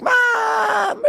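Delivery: very high mock scream; retold high-pitched squealing call; excited scream. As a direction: shriek for your mother with news. Retelling screaming the news to mom.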